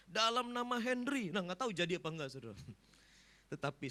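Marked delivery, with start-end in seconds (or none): 0.1-1.3 higher-pitched pretend command; half-serious casting-out call; mock exorcism command. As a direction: imitate an exorcist calling out. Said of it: "atau ngusir setan".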